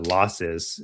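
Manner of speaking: normal talking voice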